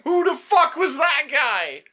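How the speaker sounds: high, loud and slow; re-enacted fury at the actor; outraged question. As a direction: spit out the question in disbelief. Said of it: "I even remember saying".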